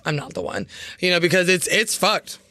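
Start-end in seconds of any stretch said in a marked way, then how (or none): 0.1-0.7 muttering under breath; a low, quiet self-talk; low muttered aside. Retold or imitated. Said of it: "I was like".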